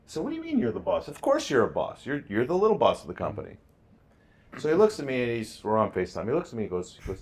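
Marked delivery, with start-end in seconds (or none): 0.2-3.6 high, bright pep-talk voice, pitch well up; excited encouragement. Retold or imitated. Dad hyping up his son on FaceTime, excited and high. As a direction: pump the kid up like a proud dad.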